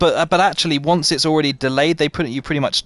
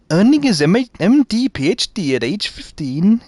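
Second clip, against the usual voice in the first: failed Welsh accent; 5 semitones higher than usual. Shown as a demonstration. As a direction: attempt Welsh lilt on the read-out, badly. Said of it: "you see, I can't do it".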